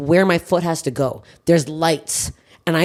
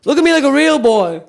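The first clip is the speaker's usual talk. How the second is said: a lightly higher, silly put-on Clifford voice; Martin Short's Clifford; 5 semitones higher than usual. Imitating the security guard for the co-host, goofy Clifford voice. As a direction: mimic the goofy guard squinting at her. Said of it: "He looked like Martin Short's Clifford".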